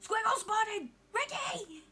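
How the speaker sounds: high, loud alert call; excited calling out. Real, not acted. Streamer spotting a friend in chat, excited calling out. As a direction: shout it like a sighting.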